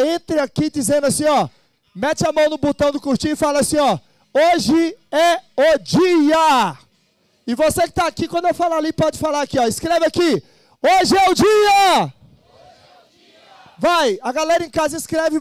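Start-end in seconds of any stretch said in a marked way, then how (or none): 4.3-6.8 very slow, each word set apart; word-by-word slogan. Shown as a demonstration. Host gives viewers a slogan to type, slow emphatic delivery. Models slogan for online audience. 10.8-12.1 high, loud, drawn-out slogan; rallying shout. Shown as a demonstration. Host leads the hall in a slogan, rallying shout.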